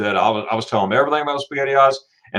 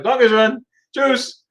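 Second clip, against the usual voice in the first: high, singsong German thanks; high-pitched polite sign-off; cheery goodbye; 12 semitones higher, much slower than usual. Retold or imitated. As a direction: imitate a bright, quick farewell.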